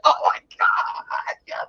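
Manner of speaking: slow whispered swoon; breathless whisper. Retold or imitated. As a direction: whisper it like you're melting. Swooning reply to friend.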